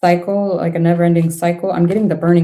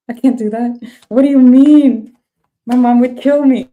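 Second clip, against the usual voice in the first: put-on higher, louder teen protest; panicky teenager; 6 semitones higher, 5 dB louder than usual. Reader voicing the child as a scared teenager, high and loud. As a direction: play a teen terrified of getting caught. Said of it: "I feel like a teenager".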